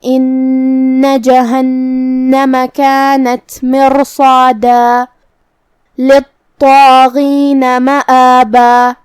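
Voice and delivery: very slow tajweed chant, stopping on a long alif; slow Quran recitation. Shown as a demonstration. Teacher recites the verse for students, slow chant. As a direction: chant the verse slowly and stop on مآبا. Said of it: "اس پہ مد الف سے رکیں گے".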